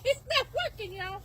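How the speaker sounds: high, loud laughing cry; laughing exclaim. Real, not acted. To her viewers about the hat, a high laughing cry. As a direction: burst out that it's failing.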